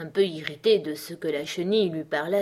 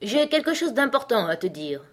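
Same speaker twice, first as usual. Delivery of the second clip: raised, higher calling voice; 6 semitones higher, 4 dB louder than usual. A narrator's reading. Caterpillar calling departing Alice back, calling out. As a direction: call after her urgently. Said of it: "lui cria la chenille".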